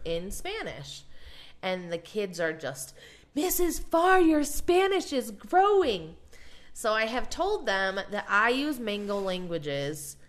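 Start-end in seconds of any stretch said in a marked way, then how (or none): 3.3-6.2 put-on high, slow excited kid voice; child voice. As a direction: sound like an impressed young student. Kids voiced in story.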